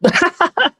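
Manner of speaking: high, shrieking cackle; witch cackle. Shown as a demonstration. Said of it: "That's how I laughed".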